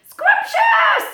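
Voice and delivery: high, loud delighted squeal; shrill joyful squirrel cry. Acted. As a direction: squeal it with glee. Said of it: "squealed the squirrel".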